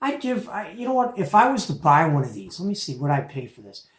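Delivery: ordinary talk, nothing marked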